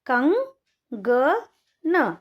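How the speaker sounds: कंगन stretched very slowly syllable by syllable; slow sounding out. Shown as a demonstration. Teacher sounding out कंगन slowly for children. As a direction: draw out कंगन slowly for the class. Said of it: "उसको उच्चारण करेंगे".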